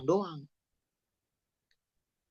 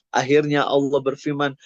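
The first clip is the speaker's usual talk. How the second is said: usual speaking voice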